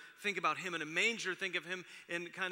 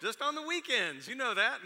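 raised, louder teasing voice of the son; mock son's teasing; 8 semitones higher, 7 dB louder than usual. Pastor imitating son ribbing him about working, higher and louder. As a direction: tease like a cheeky kid, lightly.

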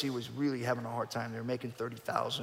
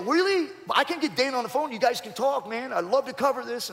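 much higher, louder and rushed; gushing reporter smelling a story; eager excitement; 11 semitones higher, 6 dB louder than usual. Retold or imitated. TMZ reporter thrilled to put him on with Dana, high and loud.

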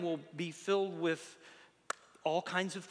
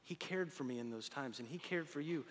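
tender; 3 semitones lower, 9 dB quieter than usual. Real, not acted. Tender aside in sermon.

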